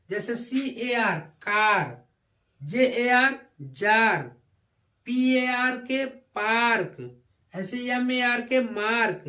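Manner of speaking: usual speaking voice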